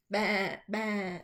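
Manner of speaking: bleating baa in a sheep voice; a hint of an excited sheep; sheep cry. A narrator's reading. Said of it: "cried Pete the sheep".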